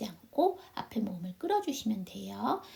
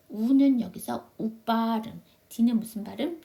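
normal talking voice

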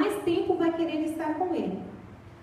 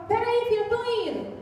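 mother answering; 7 semitones higher than usual. Retold or imitated. Mother answering her daughter, high and hurried. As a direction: hurry to answer the child.

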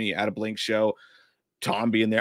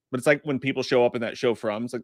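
ordinary talk

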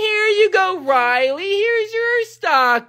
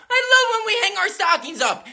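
usual speaking voice

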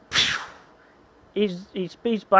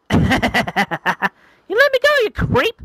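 much higher, louder and slow protest; angry girl; 12 semitones higher, 6 dB louder, much slower than usual. Acted. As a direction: struggle and scold your kidnapper.

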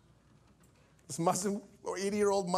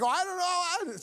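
nervous reluctance; 8 semitones higher than usual. Retold or imitated. Preacher reluctant to let clerics up.

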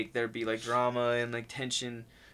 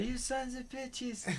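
slow, much higher mock outcry; mock yell; 10 semitones higher, 11 dB quieter, slower than usual. Yelling at the movie screen, slow and high. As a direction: stretch it out in pitched-up fake outrage. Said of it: "that's why I was yelling out".

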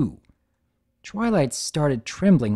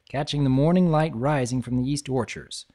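plain reading voice

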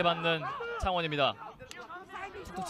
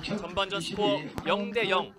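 usual speaking voice

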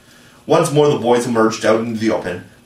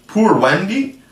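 puzzled echo; 9 semitones higher, 3 dB louder, slower than usual. Acted.

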